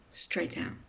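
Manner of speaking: normal talking voice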